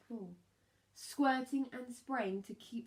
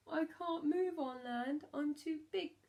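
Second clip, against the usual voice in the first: helpless reply; 7 semitones higher than usual. A narrator's reading. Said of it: "said the whale".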